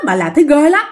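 ordinary delivery

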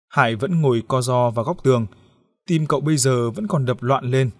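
usual speaking voice

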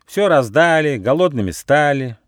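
high, indignant complaint. A narrator's reading. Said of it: "возмутился Рыж".

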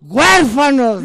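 loud, high, drawn-out shout; multitud que grita. Acted. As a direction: deliver the punchline as a crowd's cry. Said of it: "Y gritan". (grito de multitud, fuerte y alargado)

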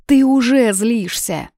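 high, loud, drawn-out accusation; provoking cry, stretched out; heated taunt. A narrator's reading. She taunts him in a quarrel.

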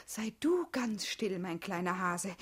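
slow, unvoiced teasing whisper. Wife teasing husband, slow playful whisper. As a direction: hush him playfully.

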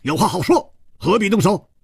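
hurried, alarmed plea for peace; urgent call. A narrator's reading. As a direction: shout to stop the fight. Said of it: "大叫道".

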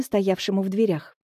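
ordinary delivery, nothing marked

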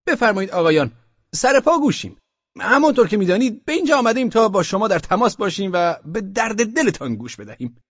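high, loud, pompous official addressing a room; a self-important bureaucrat opening a meeting; Osman Bey's official voice. Acted. Osman Bey greets the merchants in a high, pompous official voice.

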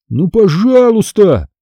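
high, drawn-out begging whine; stretched-out, high wheedling; whining plea. A narrator's reading. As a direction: whine and plead like a child. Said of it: "заныл Карло".

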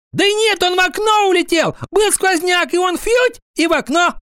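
squeaky, very high schoolboy voice; blurting out. A narrator's reading. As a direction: butt in out of turn with a theory. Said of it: "вдруг выкрикнул прямо с места". (выкрикивать с места)

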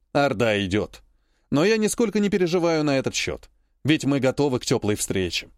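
plain reading voice

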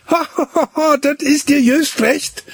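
high, mocking girl's laugh; gloating laugh. A narrator's reading. Gretlieschen laughs at the boy from the tree. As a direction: laugh at him, serves him right. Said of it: "lachte und rief".

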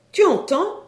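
high, loud prodding question; insistent wife. A narrator's reading. Wife pressing her husband to believe the dealer, insistent.

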